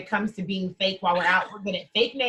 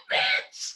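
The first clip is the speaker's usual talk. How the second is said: high laughing scream; laughing shriek. Real, not acted.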